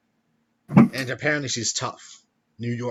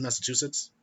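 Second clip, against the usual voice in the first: ordinary talk, nothing marked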